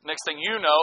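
ordinary talk, nothing marked

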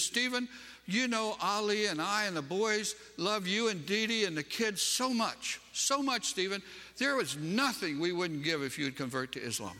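imitating a sobbing friend, pitched high then easing down; high, pleading retold voice, only lightly tearful; mock tearful plea. Preacher retelling a sobbing friend's plea to convert, tearful pleading. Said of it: "she's just sobbing".

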